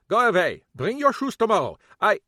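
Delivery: irate immigrant shouting at police at his door; angry German shopkeeper. Acted. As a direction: chase them off in a thick German accent. Kappelhoff, angry foreign shopkeeper.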